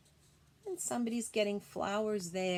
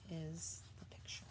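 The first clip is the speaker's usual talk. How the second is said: whisper; 4 semitones lower, 12 dB quieter than usual. Real, not acted. Reveals painted picture.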